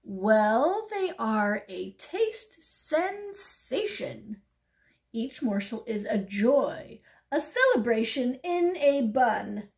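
slow, loud, grandly savoured praise; rhapsodise, stretching out the key words; rapturous pigeon. Acted. Pigeon extolling hot dogs to the duck, rapturous.

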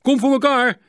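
hoge, luide roep; teruggeroepen antwoord. A narrator's reading. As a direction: roep terug naar een andere boot. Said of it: "riep Cor terug". (luid en hoog teruggeroepen)

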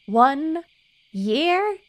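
high, loud, stunned question; shocked disbelief. Real, not acted. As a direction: blurt it out in shock. Shocked by the short lifespan.

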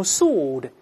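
"sword" as "sawd", silent W and no R; British pronunciation. Shown as a demonstration. Teacher showing how the British read "sword" as "sawd", British pronunciation. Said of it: "英国人读成sword".

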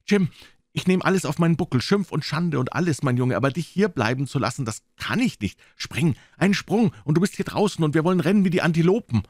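put-on high-pitched, impassioned appeal to the boy; urgent pleading doctor. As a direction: urge him to jump and run, pitch held high. Doctor urges Jim to escape.

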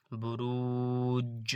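বুরুজ drawn out slowly, ending with a firm bounced জ; slow Quranic recitation. Shown as a demonstration. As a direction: recite বুরুজ with a long ু and a crisp struck final জ. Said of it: "এইভাবে উচ্চারণ করতে হবে সবগুলো".

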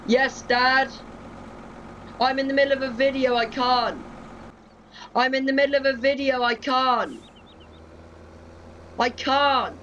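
high-pitched exasperated call to another room; calling out to Dad. Real, not acted. Gamer yelling to Dad that he's mid-video, high-pitched calling out.

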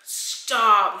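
a sudden loud delighted "Stop."; loud gushing "Stop."; excited squeal. Real, not acted. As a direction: shriek "Stop." at something too cute. Delighted shriek at cute gift.

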